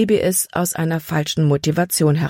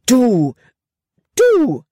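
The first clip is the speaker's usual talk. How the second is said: high, loud outburst, each word alone; sputtering anger; 8 semitones higher, 5 dB louder than usual. A narrator's reading. Libby after Caleb threw snow at her, sputtering angrily. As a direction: sputter it indignantly, jumping higher. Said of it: "sprühte sie".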